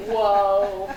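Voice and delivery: a whoa breaking into laughter; amused disbelief, laughing. Retold or imitated. Clerk laughing off the old man's threat, laughing whoa.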